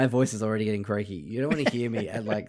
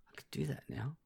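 mock inner thought; 2 semitones higher, 14 dB quieter than usual. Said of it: "'cause I'm like".

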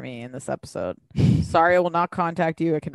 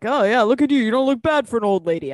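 higher, louder playful teasing; teasing ex-husband; 5 semitones higher, 5 dB louder than usual. Retold or imitated. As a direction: rib her like an old friend. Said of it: "I loved him telling her, like".

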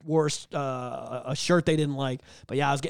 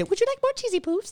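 sugary high falsetto impression; Cartman's mom. Teacher placating a kid, cooing cartoon-mom voice. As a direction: offer it sweetly, way up in a mock-mom voice. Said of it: "They have to be Cartman's mom".

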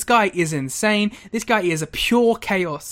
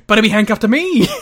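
mock gleeful heel; 4 semitones higher, 8 dB louder than usual. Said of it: "And then he goes".

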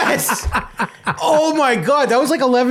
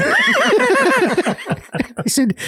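ordinary talk, nothing marked

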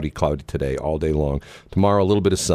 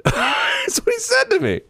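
very high squeal through laughter; real high-pitched cracking up; laughing incredulously. Real, not acted. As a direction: barely get it out for laughing. Cracking up over the man's line.

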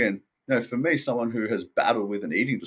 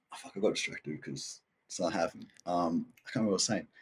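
under his breath; 5 semitones lower, 10 dB quieter than usual. Real, not acted. Loses his train of thought.

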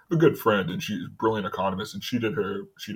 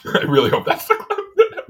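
giggling, pitched way up, slow; laughing; 9 dB louder than usual. Real, not acted. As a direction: laugh the words out high and delighted. Laughing at his own joke.

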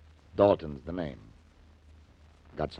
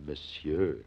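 mock French; 3 semitones lower, 5 dB quieter than usual. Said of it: "This I've never been called".

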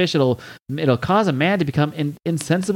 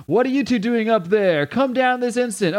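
higher-pitched mock scolding; 9 semitones higher than usual. Scotius catches two people where they shouldn't be, mock scolding. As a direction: lightly play a scolding killjoy.

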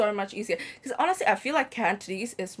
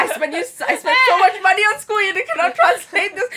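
loud, laughing scolding mom; 11 semitones higher, 9 dB louder than usual. Retold or imitated. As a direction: yell it like an exasperated mom.

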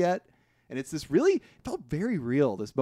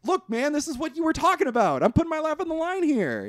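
pleading informant quoted; 13 semitones higher than usual. Retold or imitated. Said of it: "O'Neill is like".

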